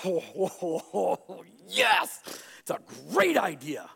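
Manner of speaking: climbing to a high squeal on yes; hammed-up delighted yes-man; eager agreement. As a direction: gush like an overeager king. King eagerly agrees to plan.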